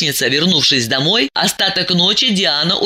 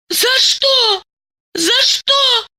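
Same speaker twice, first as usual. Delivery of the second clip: very high, bewildered cry; desperate exclamation; much slower than usual. A narrator's reading. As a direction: cry out in lost despair. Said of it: "в растерянном отчаянии воскликнула".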